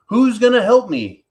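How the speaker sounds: high, loud hen voice calling for help; little red hen. Acted. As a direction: squawk it out like a bossy little hen. Little red hen asks animals.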